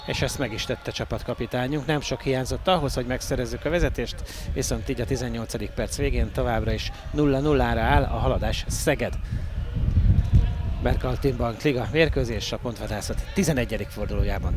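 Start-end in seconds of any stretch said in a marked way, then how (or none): none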